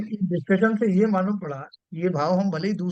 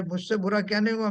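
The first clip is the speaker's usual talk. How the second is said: usual speaking voice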